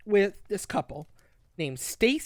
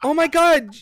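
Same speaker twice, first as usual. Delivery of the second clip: loud, raised-pitch excited outburst; excited agreement; 7 semitones higher, 7 dB louder than usual. Real, not acted. Co-host seizing on the Bayonetta comparison, excited. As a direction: pounce on the comparison, thrilled. Delighted at co-host's character comparison.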